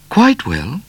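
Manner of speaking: high, curt reply; clipped in annoyance; a touch cross "Quite well". A narrator's reading. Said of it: "looking crossly at Cat".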